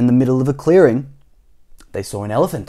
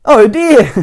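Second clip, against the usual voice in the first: put-on high, loud frightened boy; Jack's gasp; 11 semitones higher, 8 dB louder than usual. Jack gasps at crocodile teeth.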